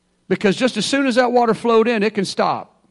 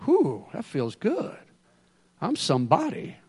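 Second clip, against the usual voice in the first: smug self-satisfied; 8 dB quieter than usual. Retold or imitated. Preacher voicing a man proud of his gifts, smug and hushed.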